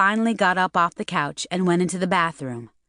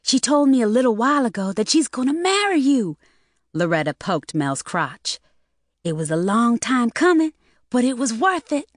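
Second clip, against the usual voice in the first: lightly voiced teasing girl; 5 semitones higher than usual.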